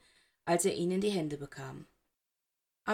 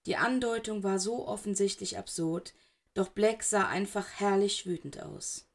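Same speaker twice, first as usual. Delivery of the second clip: usual speaking voice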